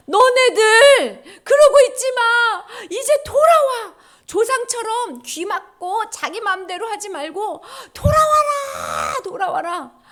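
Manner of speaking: high, slow, loud acted wail; God's anguished plea. Sorrowful God calling His people back, anguished shout. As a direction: cry out imploringly, stretching the words. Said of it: "하나님 마음이 슬펐어".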